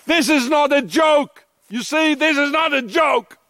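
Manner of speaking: real angry shout at the camera; furious shout. Real, not acted. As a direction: shout it out, it's no joke. Ranting at the Swiss authorities.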